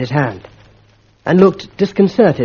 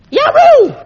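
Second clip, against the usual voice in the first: very high, loud yelp of pain; a schoolboy rapped on the knuckles; 7 dB louder than usual. Acted. Bunter struck on the knuckles.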